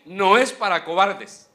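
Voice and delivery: "no es para cobardes" loud and slow, "es" hammered; preached shout. Acted. Preacher condemning cowards to the church, loud and slow. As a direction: thunder the verdict. Repeated climax line.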